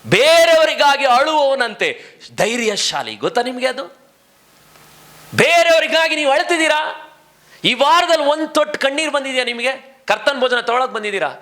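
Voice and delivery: full-force sermon climax; fiery preaching. Acted. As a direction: press the congregation hard.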